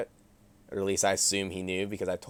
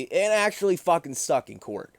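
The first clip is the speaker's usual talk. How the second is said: indignant rant; 9 semitones higher, 5 dB louder than usual. Real, not acted. Ranting about unfair charge.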